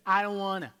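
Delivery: loud, whiny I don't wanna; childish loud reluctance; whiny refusal. Pretend. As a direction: pout it out like a stubborn kid.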